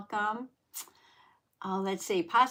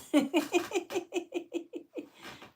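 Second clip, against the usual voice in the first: giggle; 10 semitones higher than usual. Real, not acted. Showing the cat on camera.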